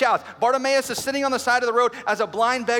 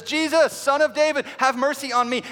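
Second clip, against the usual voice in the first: high, raised plea; crying out; 4 semitones higher, 3 dB louder than usual. Acted. Bartimaeus calling as Jesus walks by, crying out. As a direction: call out suddenly over a moving crowd. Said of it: "cries out and says".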